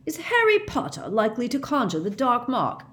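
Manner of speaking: pointed, indignant challenge; angry. A narrator's reading. Mister Weasley rebuking Amos, indignant and pointed. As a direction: demand it of him, fed up. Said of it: "very angrily".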